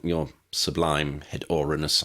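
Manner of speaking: ordinary delivery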